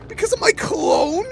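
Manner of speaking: high loud disbelieving question; disbelieving shout. Real, not acted. Repeats in louder disbelief.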